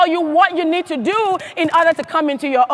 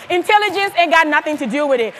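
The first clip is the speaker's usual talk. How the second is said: usual speaking voice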